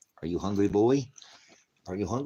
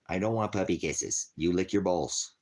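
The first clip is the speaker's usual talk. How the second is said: usual speaking voice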